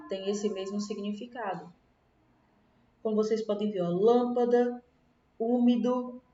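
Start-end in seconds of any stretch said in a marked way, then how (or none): 3.9-4.8 "lâmpada" slow, with a loud LÂM; stress on antepenultimate syllable. Shown as a demonstration. Teacher showing pupils the strong syllable, loud long LÂM in "lâmpada". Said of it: "qual é a sílaba mais forte?". 5.4-6.2 "úmido" with a firm Ú; stress on antepenultimate syllable. Shown as a demonstration. Teacher giving pupils an example, stressed Ú in "úmido". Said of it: "qual é a sílaba mais forte?".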